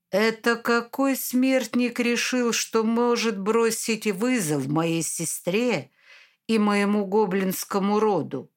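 ordinary delivery, nothing marked

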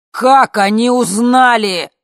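loud, high, drawn-out yell; slow full-voiced outraged shout. A narrator's reading. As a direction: bellow the question in alarm. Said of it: "заорал А".

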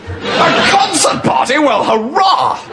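high, drawn-out cheer of delight; delighted exclamation. Acted. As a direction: thrill at the news, stretching the words. Lieutenant delighted by concert party news.

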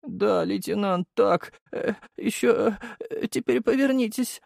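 high, slow sleeper's murmur; slow, halting sleep-talk, pitched high; dreamy moaning. A narrator's reading. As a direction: moan it blissfully in a thin high voice.

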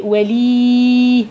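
ولي with the ي drawn out long; stretched kasra. Shown as a demonstration. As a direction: lengthen the kasra till it turns into ي. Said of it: "اما تقولي ايه".

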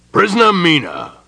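loud, high, stern address; commanding general's summons. A narrator's reading. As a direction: call the accused to attention. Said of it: "said General Connell sternly".

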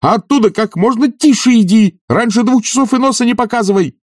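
very high urgent yell; frantic shouted order; shouting after her. A narrator's reading. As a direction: call to someone running away. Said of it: "кричал он ей вслед".

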